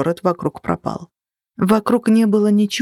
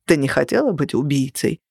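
sad; 5 semitones lower than usual. A narrator's reading. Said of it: "грустно покачал головой".

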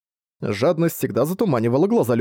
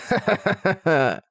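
slow, cruel, higher cackle; harsh laugh; 7 semitones higher than usual. Acted. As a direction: laugh coldly at the fool. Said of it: "жёстко рассмеялся".

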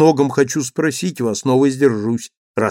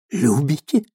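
stunned exclamation; 2 semitones higher than usual. A narrator's reading. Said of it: "воскликнул он".

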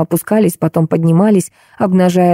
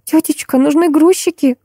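high plaintive niece; moaning; 10 semitones higher than usual. A narrator's reading. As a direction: whine a plea to an aunt in a thin voice. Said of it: "стонала племянница". (жалобно ноет тонким голоском)

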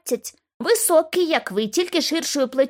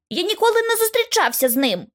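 виклична відповідь; 9 semitones higher than usual. Acted. Хлопець заперечує, голос високий.

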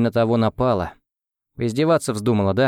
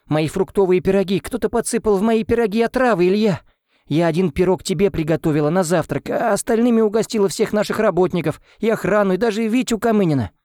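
panicked; 9 semitones higher than usual. Acted. Nanny panics about poisoned pies.

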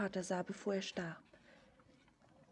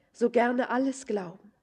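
fervent plea; 8 dB louder than usual. Acted. Longing to believe him.

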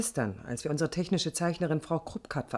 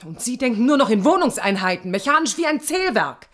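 louder and higher, hurling it back at him; angry outburst; 3 semitones higher, 8 dB louder than usual. Acted. The architect shouts back at her boss, loud and furious. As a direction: fire the accusation straight back at him, loud.

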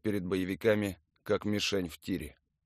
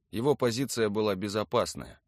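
ordinary delivery, nothing marked

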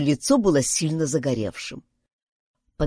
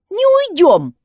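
high, loud refusal; defiant exclamation; 11 semitones higher, 7 dB louder, slower than usual. A narrator's reading. Girl refuses to leave the camp, defiant exclamation. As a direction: cry it out, standing your ground. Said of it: "воскликнула Юнис".

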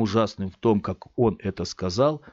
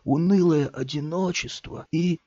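slow, self-pitying croon; pitiful; 4 semitones higher than usual. A narrator's reading. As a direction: beg for sympathy. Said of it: "жалостливым голосом".

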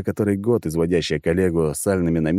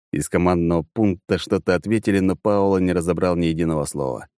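plain reading voice

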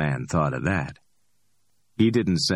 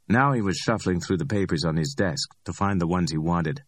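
plain reading voice

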